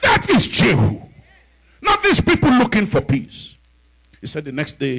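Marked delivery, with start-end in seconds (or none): 0.0-3.5 high, loud preaching over music; full-force preaching. Real, not acted. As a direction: preach it with rising fire.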